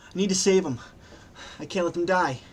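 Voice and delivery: high, urgent, distraught voice; acted frantic insistence; panicking. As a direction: plead desperately in rising panic. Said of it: "she's just panicking".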